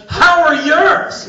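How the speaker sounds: high, loud, drawn-out comeback; shouted retort. Retold or imitated. Iranian guests challenge visitor on American elections, shouted retort. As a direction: fire it back slowly at full voice. Guests hurl the question back.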